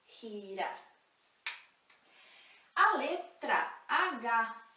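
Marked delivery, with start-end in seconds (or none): 0.1-0.8 girar with a rasping h-sound; Spanish g as h. Shown as a demonstration. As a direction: say girar with a throaty h at the start, hirar. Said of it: "esse sonzinho de dois R's".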